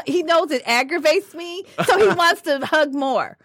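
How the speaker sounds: ordinary talk, nothing marked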